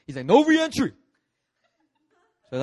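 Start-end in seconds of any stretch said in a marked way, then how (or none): 0.3-0.9 high, loud bouncer shout; mock-angry yell, pitched way up; shouted refusal. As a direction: bark it as a final order. Bouncer refuses again, more forcefully.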